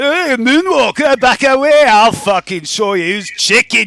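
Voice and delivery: put-on high, loud take on Dukal's accent; mimicking Dukal's accent. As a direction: shout the taunt in Dukal's accent. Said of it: "he says it in his accent".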